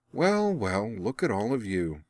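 amused, wry drawn-out well well; wry smile. Acted. Azula greeting her old acquaintances at the table, wry. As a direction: savour the moment with a smirk. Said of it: "smiling wryly".

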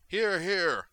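loud, high cheer of agreement; rousing heckle from the back; shouted approval. A narrator's reading. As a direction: shout it across the room. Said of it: "one of the delegates shouted from the back".

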